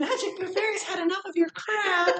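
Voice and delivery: very high squeaky fed-up fairy. Acted. Younger sister as the rebelling fairy, high squeaky voice. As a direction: squeak it like a fairy who quit. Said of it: "the high squeaky voice would say".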